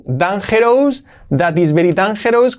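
"dangerous" as dan-HE-rous; wrong Spanish accent. Shown as a demonstration. Teacher shows the learner's mistake: "dangerous" as dan-HE-rous. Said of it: "Cuidado que no es dangerous".

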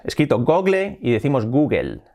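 usual speaking voice

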